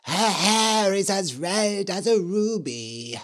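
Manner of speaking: eerie ghost witch voice; lightly voiced spooky witch. A narrator's reading. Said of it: "said the Red Witch".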